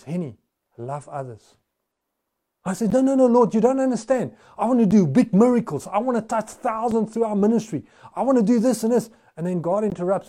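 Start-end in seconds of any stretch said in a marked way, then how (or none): 0.0-1.6 soft, calm, much quieter answer; God quietly answering Henny; gentle voice of God. Acted. God answers the preacher's prayer. 2.9-9.1 higher, insistent pleading with God; preacher protesting to God, eager. Acted. Arguing back to God.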